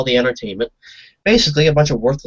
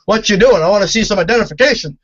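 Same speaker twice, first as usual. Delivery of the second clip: loud, higher cop demand; mock cop; 7 semitones higher, 5 dB louder than usual. Imitating a cop demanding ID from the filmer. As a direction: bark it like a bossy cop. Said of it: "I don't know if they say it like that".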